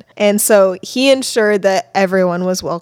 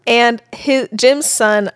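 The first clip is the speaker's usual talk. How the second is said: usual speaking voice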